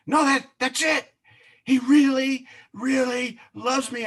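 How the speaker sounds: high, cracking voice through tears; crying joyfully, pitch way up; weeping. Retold or imitated. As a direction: sob out that he really loves you. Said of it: "just weeping".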